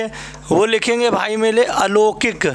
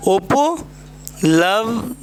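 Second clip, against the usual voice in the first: उपलब्ध stretched very slowly, syllable by syllable; drawn-out word; much slower than usual. Shown as a demonstration. Teacher slowly sounding out a misspelt word. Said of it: "ये आपका उपलब्ध जो है वो आपका कैसा है".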